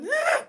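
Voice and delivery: short, high-pitched mock scream; imitated scream. Actor in a haunted house scaring visitors, high mock scream. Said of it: "qui fait en mode".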